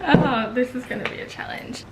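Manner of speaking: breathy laugh through words; laughing whisper. Real, not acted. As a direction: giggle it out, half whispered. Trying to eat with numb face.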